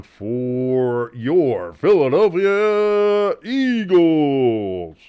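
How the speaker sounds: slow, drawn-out announcer boom on Philadelphia Eagles; stadium announcer. Acted. Host calls an Eagles first down as a slow, booming stadium announcer. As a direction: stretch it out like the stadium PA guy. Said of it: "like, a stadium voiceover guy".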